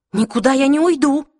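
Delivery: loud, high defiant refusal; stubborn. A narrator's reading. Clary refusing to leave, stubborn. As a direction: dig in and raise your voice. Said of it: "упрямо ответила Клэри".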